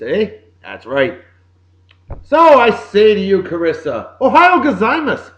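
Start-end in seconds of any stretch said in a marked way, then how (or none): 4.2-5.3 jokey high and louder Japanese greeting, hammed-up accent; mock Japanese greeting. Host greeting Carissa on her birthday, hammed-up mock Japanese accent. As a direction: throw out a cheesy mock-Japanese good morning. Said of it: "So a little Japanese".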